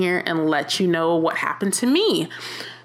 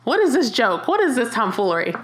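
a touch of laughing disbelief, pitched up; amused disbelief; 5 semitones higher than usual. Retold or imitated. Thinking the boss's complaint is a joke, amused disbelief. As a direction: treat it like a silly joke. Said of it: "I thought it was a joke".

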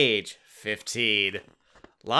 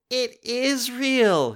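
high, slow whiny complaint; complaining; 13 semitones higher, much slower than usual. Acted. Katie complains to Jordan in a slow, high whine. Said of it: "Katie complains".

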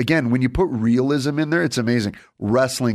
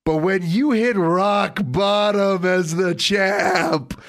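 hyped wrestling promo; 7 semitones higher, slower than usual. Acted. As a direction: sell it like a ring announcer. Building hype wrestling prediction.

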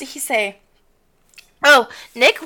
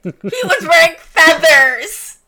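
laughing through the words, slow and louder; 5 semitones higher, 6 dB louder, slower than usual. Real, not acted. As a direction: giggle out the words in disbelief. Laughing in disbelief at feathers.

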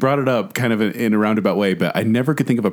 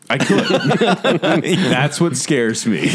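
laughing while saying it; 6 semitones higher, slower than usual. Real, not acted. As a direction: laugh through the words. Laughs through the line.

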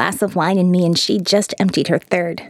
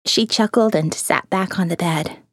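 ordinary delivery

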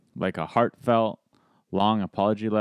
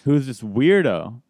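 imitating an indignant boyfriend, drawn-out words; mock-indignant, stretching out the accusation; slow, mildly upset retelling; 3 semitones higher, 3 dB louder, slower than usual. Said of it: "he's upset too because he's going".

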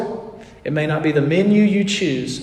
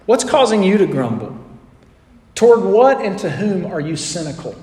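ordinary talk, nothing marked

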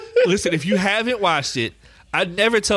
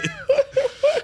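high laugh. Real, not acted. Big laugh.

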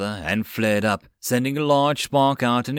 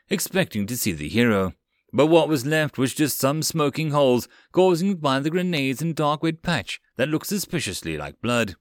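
plain reading voice

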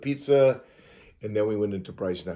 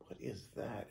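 much softer, hushed wondering; hushed question; 17 dB quieter than usual. Retold or imitated. Spotting movement on the dark road, hushed. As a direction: ask it under your breath.

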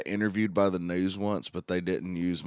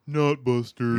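higher-pitched mock heckle; imitating brother's dad; 6 semitones higher, 4 dB louder than usual. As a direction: call it out like a dad watching wrestling. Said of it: "dad would always be like".

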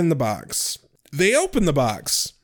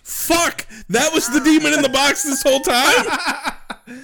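mock shock; 9 semitones higher than usual. Real, not acted. Shocked reaction to demon reveal.

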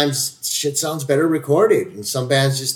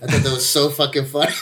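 ordinary talk, nothing marked

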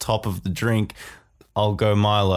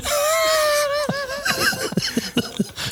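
wailing sung cry. Pretend. Wailing jingle ending.

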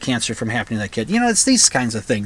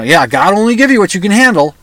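higher, louder sarcastic singsong on give; mock the pious saying bitterly; 6 semitones higher, 6 dB louder than usual. Mocks the phrase beside starving child.

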